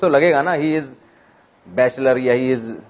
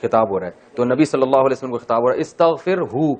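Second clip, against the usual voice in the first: ordinary talk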